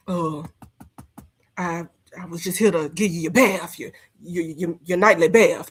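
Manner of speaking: low, stammering, nervous servant voice; timid houseboy voice. Acted. Host mocking Gerard as Stella's timid houseboy, low and stammering. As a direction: stutter it out like a scared houseboy at the door. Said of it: "You probably gave Stella a bath".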